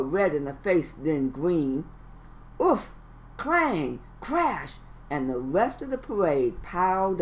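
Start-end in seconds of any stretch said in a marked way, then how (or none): 2.6-4.8 bang out each noise; crash noises. Acted. Parade piles onto Froggy, punchy sound effects.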